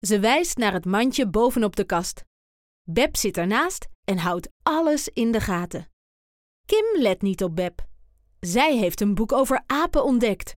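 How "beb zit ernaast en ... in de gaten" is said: ordinary delivery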